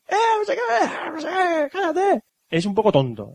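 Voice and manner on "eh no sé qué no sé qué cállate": mucho más agudo, entre risas, grito en broma; imitando a Luffy gritando. Retold or imitated. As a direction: imita a Luffy chillando sin pensar, riéndose. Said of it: "él se pone a gritar". (Luffy gritando cuando hay que callar, imitación aguda)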